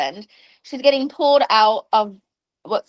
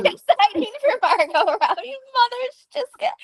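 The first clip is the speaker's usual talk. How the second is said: high, laughing through the repeat; laughing echo; 11 semitones higher than usual. Real, not acted. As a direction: giggle while parroting her words.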